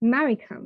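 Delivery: America with no first A, said 'merica'; America without the first sound; silent first A. Shown as a demonstration. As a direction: drop the opening vowel of America. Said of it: "এটা একদম silent চলে যাবে".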